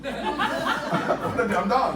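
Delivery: very slow, drawn out through laughter; savoring the punchline while cracking up; laughing. Real, not acted. The speaker repeats his own punchline to the crowd, laughing. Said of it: "repeating it, laughing".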